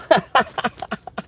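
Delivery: high burst of laughter. Real, not acted. Telling of two co-workers fired, high laughter.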